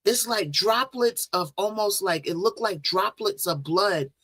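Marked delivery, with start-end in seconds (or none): none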